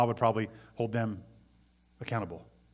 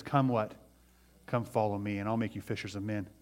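gentle call; 4 semitones lower, 6 dB quieter than usual. Retold or imitated. Jesus calls the fishermen.

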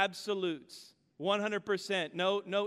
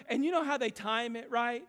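usual speaking voice

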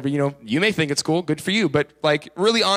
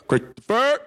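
name barked in mock fatherly sternness, pitched up; imitated stern dad; 7 semitones higher, slower than usual. Dad calls his son, imitated stern manly call. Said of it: "a stern, manly voice".

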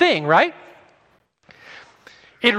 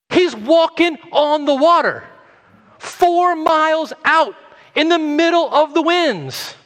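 dramatic rising preach; 9 semitones higher, 3 dB louder than usual. Acted. Preacher builds dramatic scene.

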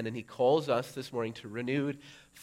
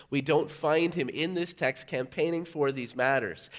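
loud, high preaching; fervent preaching; 5 semitones higher, 8 dB louder than usual. Acted. Preacher urging the congregation, loud and high. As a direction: preach it with rising fire.

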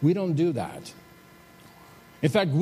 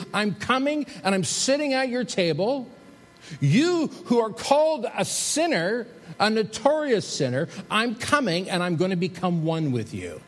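higher, earnest proclamation as Jesus; emphatic Jesus; 7 semitones higher than usual. Acted. Pastor voicing Jesus to Zacchaeus, raised emphatic preaching. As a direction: announce it like good news to a sinner.

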